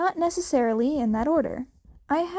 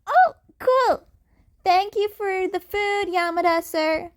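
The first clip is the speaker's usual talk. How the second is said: much higher, louder, sweet; polite kid Deku; 7 semitones higher, 5 dB louder than usual. A narrator's reading. Cat kid thanking Yamada for food, high and sweet. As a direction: thank him like a polite little boy.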